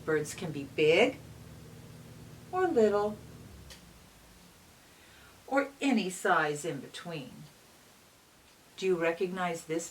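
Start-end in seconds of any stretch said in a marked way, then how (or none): 0.0-7.3 bright, lively read-aloud voice; reading to children. A narrator's reading. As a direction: read it like storytime for little kids. Reads picture book aloud.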